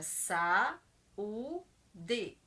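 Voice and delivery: sa, then u, d, each syllable said separately; syllables split apart. Shown as a demonstration. Teacher splitting sa u d for the class. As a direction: spell out the syllables slowly. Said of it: "O exemplo, saúde".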